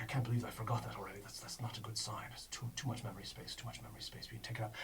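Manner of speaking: quick worried mutter under the breath; quiet muttering. Acted. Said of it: "He's like, he's like".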